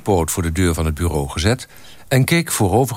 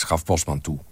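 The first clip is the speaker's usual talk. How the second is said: ordinary delivery, nothing marked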